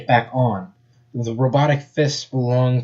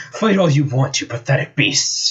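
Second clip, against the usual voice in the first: sneering villain; 3 semitones higher than usual. Acted. Eggman taunts the fighting heroes, sneering. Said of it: "sneered Eggman".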